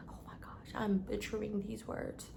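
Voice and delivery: embarrassed whisper to herself; whispered aside. Real, not acted. To herself after stumbling over a word, whispered. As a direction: breathe it under your breath, flustered. Flustered aside about mispronouncing.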